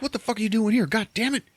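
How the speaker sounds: high-pitched mock angry rant; exasperated outburst. Man scolding the kid who followed him, exasperated.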